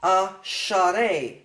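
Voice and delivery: asharei slowly as a-sha-rei, with an added A after sh; extra sound. Shown as a demonstration. Teacher showing how they read asharei, extra-sound demonstration. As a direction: draw out asharei in three beats, a-sha-rei. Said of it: "You need an extra sound".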